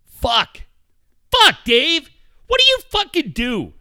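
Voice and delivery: high, loud exasperated rant; half-laughing squeal of frustration; mock outburst. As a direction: throw up your hands at Dave. Ranting at Dave, much higher.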